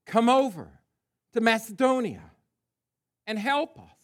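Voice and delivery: loud, high, spaced-out call; urgent plea. A narrator's reading. Man in a vision begs Paul for help, urgent plea read aloud. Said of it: "urging him and saying".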